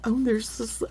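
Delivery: usual speaking voice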